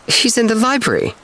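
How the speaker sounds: put-on high, disdainful sniff of an old aunt; sniffy aunt. A narrator's reading. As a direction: toss it off with prim disdain. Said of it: "sniffed Aunt Gertrude".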